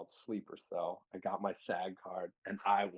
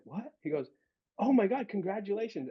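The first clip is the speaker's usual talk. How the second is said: much higher, astonished cry; surprised dad; 12 semitones higher, 3 dB louder than usual. Retold or imitated. Dad seeing the new car, astonished. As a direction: burst out in amazed delight.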